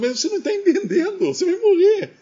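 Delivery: high, fast, urgent insisting; alarmed doctor pressing the point; exasperated doctor. Retold or imitated. Doctor insists patient will die.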